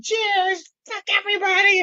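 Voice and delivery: high, rowdy mock toast; imitating a reckless cheer. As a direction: raise a glass and yell it gleefully. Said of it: "she's cheering".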